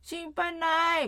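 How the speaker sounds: put-on English-accented Japanese. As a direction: stretch it like an English speaker attempting Japanese. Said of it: "英語なまりの日本語".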